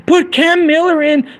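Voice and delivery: squeaky high mocking fan voice; high mocking fan squeal; mocking dumb fan. Mocking dumb Raider fans.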